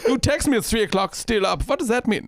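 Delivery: high mock-German accent. Hungover soldier recalls a text, in a German accent. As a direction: puzzle it out in a comic German accent. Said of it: "still doing the German ac".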